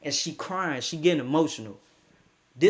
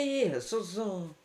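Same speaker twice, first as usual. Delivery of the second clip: ordinary talk, nothing marked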